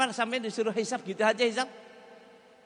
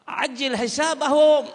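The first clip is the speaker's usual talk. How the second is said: high, loud, slow plea; impassioned cry; urgent plea; 7 semitones higher, 10 dB louder, slower than usual. Acted. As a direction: beg urgently, ringing out.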